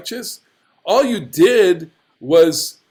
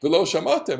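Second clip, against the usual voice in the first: lofty; 4 semitones higher than usual. Acted. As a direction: intone it like a commandment. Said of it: "he's using very lofty language".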